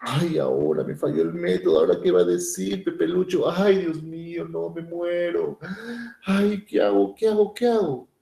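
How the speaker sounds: voz aguda de hembra angustiada; hembra asustada. Retold or imitated. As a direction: lamenta con pánico fingido. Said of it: "Ella muy asustada". (la hembra teme al macho, voz aguda asustada)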